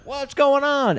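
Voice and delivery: high-pitched mock alarm; startled demand. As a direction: call it out as a startled character. Voicing a startled Dean in retelling.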